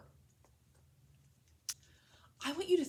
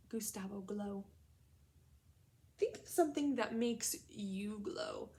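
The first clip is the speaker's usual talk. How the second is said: slow whispered prompt; hushed classroom whisper; 3 semitones lower, slower than usual. Real, not acted. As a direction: whisper it to draw them in. Teacher prompts class to think.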